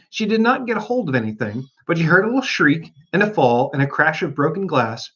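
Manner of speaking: usual speaking voice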